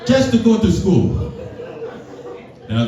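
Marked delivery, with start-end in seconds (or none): 0.1-1.1 high, slow mock mom lecture; high-pitched imitation of his mom, slow and preachy; immigrant mom voice. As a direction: ham up a strict Vietnamese mother. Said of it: "she's like".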